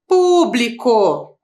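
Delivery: "Público" spelled out slowly with a falling end; slow dictation. Shown as a demonstration. Teacher dictating the next word to students, slowly. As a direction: lay out "Público" syllable by syllable. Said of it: "Olha a próxima lá".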